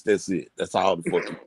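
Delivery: usual speaking voice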